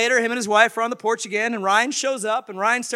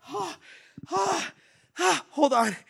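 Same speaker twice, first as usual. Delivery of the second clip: acting winded, high gasps; mock breathless gasping; out of breath; 9 semitones higher, slower than usual. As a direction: pant between the words as if just arrived running. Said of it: "and he's out of breath".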